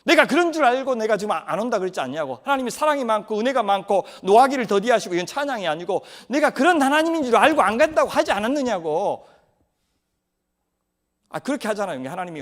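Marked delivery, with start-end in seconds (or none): 0.0-5.2 loud, sulky yelling at God; furious complaint, voice raised; angry outburst. Acted. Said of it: "성질 내죠". 6.3-9.3 much higher, loud angry yell; hammed-up tantrum, pitch way up; furious shout. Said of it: "성질 내죠".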